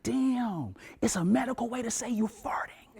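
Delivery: hushed, voiceless whisper of awe; whispered aside. Retold or imitated. A student whispering to classmates in class, hushed. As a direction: whisper it to the kid beside you, impressed.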